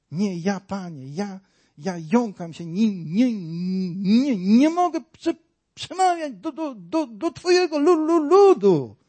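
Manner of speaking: high, halting stammer of a frightened Moses; stuttering Moses. Retold or imitated. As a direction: stammer out the refusal, pitch climbing. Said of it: "No tak by Mojżesz mówił".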